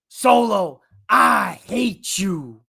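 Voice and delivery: gruff slow growl of a brooding wrestler; Jacob Fatu impression. Wrestler to Solo, bitter impression slow and growled. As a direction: snarl it out like Fatu. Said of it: "Should I, should I do it?".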